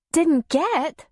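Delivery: high, doubting echo; sceptical. A narrator's reading. Darla doubting Tom's excuse, sceptical. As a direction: throw his words back.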